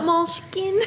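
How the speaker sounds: put-on high, called out with Spanish accent. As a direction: sing it out like a doting Spanish mom. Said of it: "With the Spanish accent".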